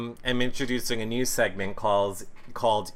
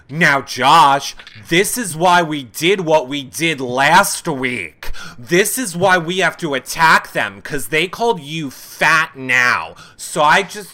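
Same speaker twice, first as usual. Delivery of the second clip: mock Paul pep talk; 3 semitones higher, 6 dB louder than usual. Mimicking Paul rallying Josh.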